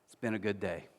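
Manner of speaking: low, soft, hushed line; grieving father. Retold or imitated. Widowed father breaking his silence to his sons, low and soft. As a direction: say it gently, barely above a breath. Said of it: "he said this".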